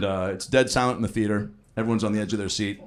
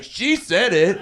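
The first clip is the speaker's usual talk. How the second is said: put-on bratty kid; 10 semitones higher, 4 dB louder, much slower than usual. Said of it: "I was like".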